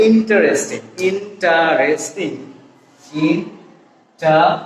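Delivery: slow interesting with every vowel, in-ta-res-ting; common full pronunciation. Shown as a demonstration. Teacher showing what we always say, interesting slowly as in-ta-res-ting. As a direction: sound out all four syllables of interesting. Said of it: "we always say".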